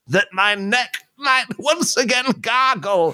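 hammy high desperate plea; dry man voice. Acted. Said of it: "I'll be the dry man".

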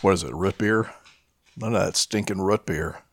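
ordinary talk, nothing marked

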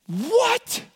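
leaping high astonished question; a jolted gasp of a question; shocked what. Acted. Mock disbelief at the payout.

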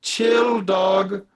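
high, slow laid-back chill dog; calm him down like a bro; alien saying chill. Acted. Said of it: "they go".

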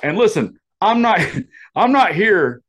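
imitating a new boss making a reassuring announcement; mimicking the new boss. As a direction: put on the boss's earnest reassurance. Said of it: "the way he said it".

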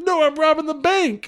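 high, strained comic retort; hammed-up arguing robber; mock exasperation. Acted quarrel in restaurant.

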